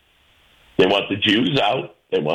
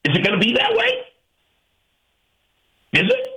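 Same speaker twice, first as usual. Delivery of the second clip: incredulous challenge; 13 semitones higher, faster than usual. Real, not acted. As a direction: voice leaps high in disbelief. Challenging imagined opponents.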